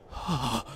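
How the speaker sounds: a short startled gasp of air; a swordsman jolted out of terror, gasping; sharp gasp. A narrator's reading. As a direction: gasp as the nightmare breaks. Hisagi snaps out of terror.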